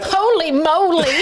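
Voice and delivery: slow, high, laughing amazement; drawn-out gasp of disbelief, laughing; high laughing holy moly; amazed holy moly. Real, not acted. Amazed reaction to parasite count.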